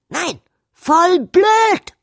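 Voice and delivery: high, loud and drawn-out sulky bark; snapping back. Acted. Princess snapping back at the queen about her name, loud. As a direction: bark it back slowly in defiance. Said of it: "blaffte P F zurück".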